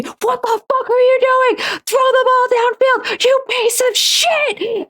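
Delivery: high, strained mock yelling; imitated screaming dad. Dad screaming at the football on TV, imitated yelling. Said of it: "he would just scream at the T V".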